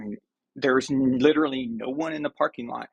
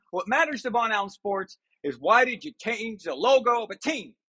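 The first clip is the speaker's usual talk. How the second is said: higher, hammed-up dismissive rant; mock rant; 7 semitones higher than usual. Vlogger ranting about a team logo, mock outrage. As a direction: wave off world news in mock outrage.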